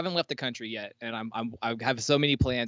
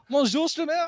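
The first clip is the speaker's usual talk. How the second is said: very high hammed-up French greeting; mock French accent; 4 dB louder than usual. Streamer greets as the French fan, very high mock French accent. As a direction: sing out bonjour like a cartoon Frenchman. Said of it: "I don't know why he's French".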